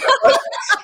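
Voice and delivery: a sudden high laugh breaking into the story; burst of laughter. Real, not acted. Guest laughing while retelling the doctor's joke, high laugh.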